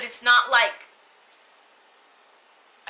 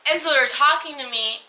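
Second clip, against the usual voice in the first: usual speaking voice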